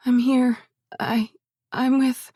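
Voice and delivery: halting, tripping over the words; can't get the words out; stuttering. A narrator's reading. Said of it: "I stuttered".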